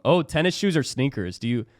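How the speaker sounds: usual speaking voice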